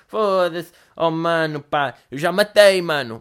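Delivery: lightly voiced street accent; street slang accent. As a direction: tough guy bragging in street accent. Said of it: "o gajo está a me dar bué de sotaque".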